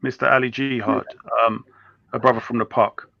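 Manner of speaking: ordinary talk, nothing marked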